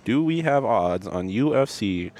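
slow, drawn-out words while searching; stalling, dragging out each phrase. Real, not acted. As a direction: stretch the words to buy time. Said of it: "I love your stalling voice".

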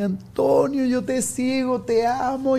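high-pitched, effusive lady fan; gushing fan. Retold or imitated. Lady greeting Antonio in the park, gushing. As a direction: imitate a gushing admirer. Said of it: "una señora me llega y me dice".

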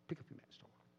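whispered. Acted. Jesus quoted to healed man.

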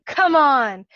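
high, loud exasperated yell; exclaimed. A narrator's reading. Coworker steps in giraffe mess, exclaiming loudly. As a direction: cry out in exasperation. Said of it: "and exclaimed".

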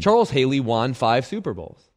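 "won" as wahn, in a low, serious statement; odd pronunciation of won. Shown as a demonstration. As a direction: say "won" like the Wan in Obi-Wan. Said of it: "What are you, Obi-Wan Kenobi?".

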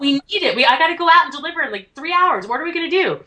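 high, fast, rushed mock panic; frantic retold boss. Tony panicking hours before going onstage, high and fast. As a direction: rattle it off high like the clock is running out. Tony's panic about the show.

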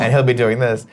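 ordinary talk, nothing marked

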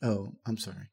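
usual speaking voice

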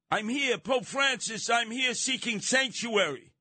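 high, slow mock pleading at the gate; pleading migrant. Migrants begging at Vatican door.

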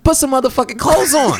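high-pitched fed-up order, retold; comic high-voiced protest; mock exasperated demand. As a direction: act out incredulous exasperation. Retells his side of the stalemate.

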